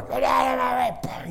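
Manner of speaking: a high, loud furious scream. Retold or imitated. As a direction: scream at the man blocking the door. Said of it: "this man was screaming".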